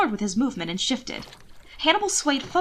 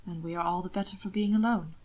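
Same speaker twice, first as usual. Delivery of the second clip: a touch of soft intimate voice; 4 semitones lower, 6 dB quieter than usual. A narrator's reading. Hannibal's intimate answer.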